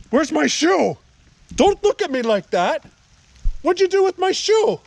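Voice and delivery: high, loud demand to the dog; real indignant yelling; exasperated scolding. Real, not acted. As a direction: scold the dog at the top of your pitch. Scolding the dog over the shoe.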